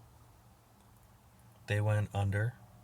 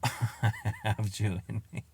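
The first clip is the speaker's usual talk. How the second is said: laughing. Real, not acted. Laughing at his own result.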